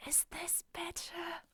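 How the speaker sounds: slow, very soft, airy whisper; telepathic whisper. Acted. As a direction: whisper it slowly into someone's mind. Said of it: "like, telepathically whisper".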